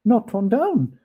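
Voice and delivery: loud, high, disbelieving joy; excited cry. Acted. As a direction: cry out in relief. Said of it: "cried Scrooge".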